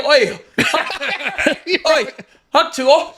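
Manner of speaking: put-on giggling through a failed British accent; bad British accent. Man tries Hawk Tuah in a British accent, laughing mock British. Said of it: "say Hawk Tuah with a British accent".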